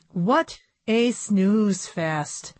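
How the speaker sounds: usual speaking voice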